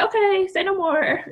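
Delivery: high, imitated placating mom; mom backing off. Mom told to stop talking, imitated high-voiced retreat. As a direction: pitch it way up, lightly giving in. Mom backs off after being silenced.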